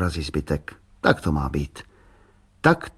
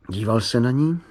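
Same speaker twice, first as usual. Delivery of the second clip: ordinary delivery, nothing marked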